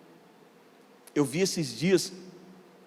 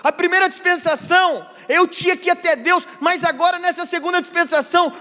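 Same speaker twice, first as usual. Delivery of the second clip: higher and louder, building fervour; fervent preaching; 13 semitones higher, 6 dB louder than usual. Acted. Pastor exhorting the congregation, loud and fervent. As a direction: preach it out to the whole church.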